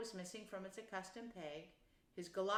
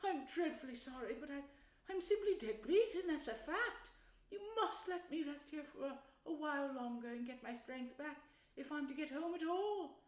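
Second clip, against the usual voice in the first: high little character voice, worn out; exhausted, pleading Mole; 8 semitones higher than usual. Acted.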